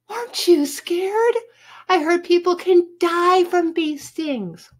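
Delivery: put-on high, alarmed girlish gush; hammed-up girly worry; fawning girl. A narrator's reading. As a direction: fret over him in a high voice. Judy fussing over the sting.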